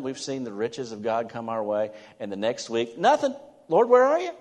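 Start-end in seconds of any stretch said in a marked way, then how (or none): 3.0-4.3 high, loud mock wail to God; whining complaint. Believer complaining to the Lord after a dry week, high mock wail. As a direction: play the disappointed believer crying out.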